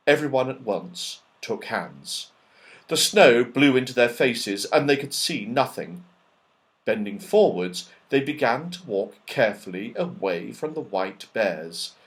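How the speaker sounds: usual speaking voice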